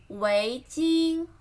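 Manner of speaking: usual speaking voice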